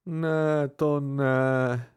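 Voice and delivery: drawn-out groan of dismay. A narrator's reading. Narrator mortified by his friends' teasing, groaning. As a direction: groan it in mock-tragic embarrassment. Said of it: "zastenal jsem".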